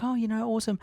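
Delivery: put-on high chirpy nice kid; mock sweet kid. Future villain kid acting nice, high chirpy voice. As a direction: play the cute innocent kid.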